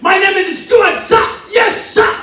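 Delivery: stiff recruit snapping answers upward; eager army recruit. Acted. Recruit answering drill sergeant.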